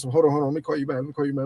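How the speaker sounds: low, soft rushed mumble; mumbling quote. Retold or imitated. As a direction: brush someone off quietly. Quoting friend hanging up.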